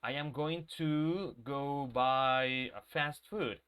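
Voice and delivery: slow, stiff, every syllable of going to spelled out; overly careful speech. Shown as a demonstration. Teacher mocking unnatural textbook English, slow and stiff. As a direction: drag it out like a robot reading a textbook. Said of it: "No, people don't talk like that".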